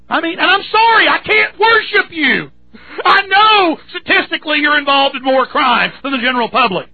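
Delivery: real fury at the officer, voice breaking; heated outraged rant. Real, not acted. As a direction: let the anger boil over into a shout. Outraged rant against police.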